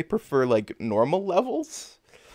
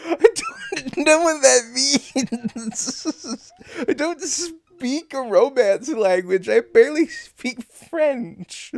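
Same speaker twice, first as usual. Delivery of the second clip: high, loud, helpless laughter through the words; laughing hard; 10 semitones higher, 5 dB louder, much slower than usual. Real, not acted. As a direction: squeal it out while cracking up. Helpless laughing at foreign text.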